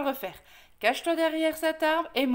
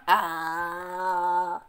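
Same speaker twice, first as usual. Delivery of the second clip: long, low, sustained "aaaah"; wider open-mouthed "ah"; 7 semitones lower than usual. Acted. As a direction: open wide and hold a deep "ah". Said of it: "ouvre plus grand". (long « aaah » grave, gueule grande ouverte)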